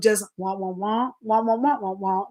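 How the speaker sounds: soft droning wah wah; lightly imitated cartoon teacher drone; Charlie Brown teacher wah. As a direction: mimic the muffled trombone teacher voice. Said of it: "like Charlie Brown teacher".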